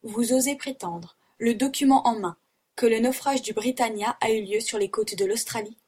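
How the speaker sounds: ordinary delivery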